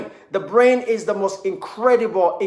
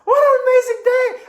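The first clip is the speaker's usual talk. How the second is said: much higher, loud delighted gushing; giddy, pitched way up with delight; gushing joy; 13 semitones higher, 3 dB louder than usual. Pretend. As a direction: squeal with over-the-top happiness. Overjoyed person looking around.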